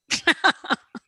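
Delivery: high burst of laughter; bright laughing burst. Real, not acted. Laughing at own joke.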